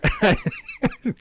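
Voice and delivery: high-pitched real giggle; helpless high-pitched giggling. Real, not acted. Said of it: "You had to giggle at the".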